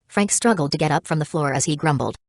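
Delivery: plain reading voice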